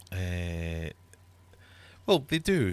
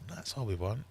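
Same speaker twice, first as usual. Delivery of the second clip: ordinary delivery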